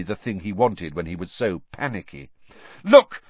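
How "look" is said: loud, high angry cut-in; furious boy interrupting a teacher. Acted. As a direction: snap at him to listen. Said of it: "said Harry angrily".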